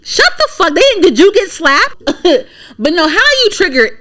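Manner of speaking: very high, exasperated comeback; incredulous retort. Acted. Cutting off a comedian's complaint, high incredulous retort. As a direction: shoot up high in disbelief.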